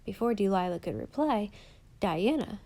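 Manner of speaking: usual speaking voice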